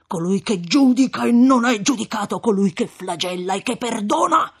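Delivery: rising to furious, loud and high; indignant rebuke. Acted. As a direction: thunder at him like a prophet. Frate rimprovera Renzo con sdegno. (frate indignato che grida)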